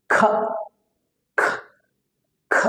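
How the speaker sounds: short, aspirated "খ", each one separate; isolated K sound. Shown as a demonstration. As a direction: puff out "খ" briefly, pausing after each. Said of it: "সাউন্ডটা কিভাবে হবে?". (ছোট করে আলাদা আলাদা "খ" ধ্বনি)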